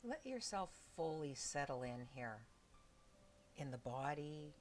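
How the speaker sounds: soft, unhurried meditation voice with long pauses; hushed body-scan guidance, leaving space between phrases; guided meditation. Real, not acted. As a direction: gently guide them into stillness, phrase by phrase.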